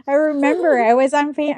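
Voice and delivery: ordinary talk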